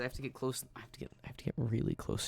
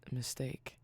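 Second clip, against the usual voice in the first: sultry close-mic voice. Acted. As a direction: lean into the mic, intimate. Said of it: "my sultry speaking voice".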